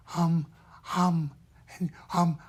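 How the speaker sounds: put-on small airy child's "um"; hesitant little puppet sound. Shown as a demonstration. Teacher showing a child puppet's core sound, small airy voice. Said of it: "This is the voice for a child".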